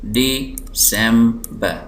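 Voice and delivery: December slowly as DE, sem, ber; syllable by syllable. Shown as a demonstration. Teacher showing three syllables, December slowly split. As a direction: separate the three beats of December. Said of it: "तीन syllable".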